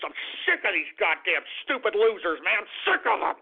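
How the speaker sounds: ordinary talk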